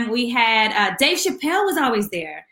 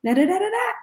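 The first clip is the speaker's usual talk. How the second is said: high sing-song da-da-da mimicry; mimicking her talk; 10 semitones higher, faster than usual. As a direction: tease a diva by parroting her high voice. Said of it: "talk like this".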